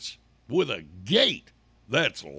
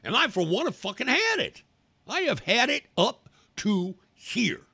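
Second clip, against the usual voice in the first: fed-up, raised-pitch rant; pissed off; 9 semitones higher than usual. Real, not acted. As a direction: vent exasperation, punching the words. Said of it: "I just get so fucking pissed off".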